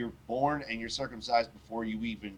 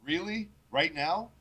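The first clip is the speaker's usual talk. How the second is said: a higher, disbelieving "Really? Right now?"; incredulous Abraham; 7 semitones higher, slower than usual. Retold or imitated. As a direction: ask it in stunned disbelief, pitch rising. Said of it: "And he goes".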